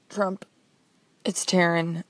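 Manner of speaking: ordinary talk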